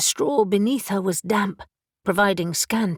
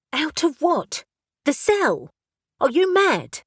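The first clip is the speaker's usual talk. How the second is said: incredulous retort; 7 semitones higher, 3 dB louder than usual. Acted. Elle argues with raven.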